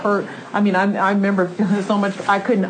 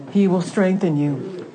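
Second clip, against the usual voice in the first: ordinary talk, nothing marked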